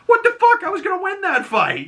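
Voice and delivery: very high squeaky indignant voice; outraged Peacemaker. Retold or imitated. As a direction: whine that your fight was stolen.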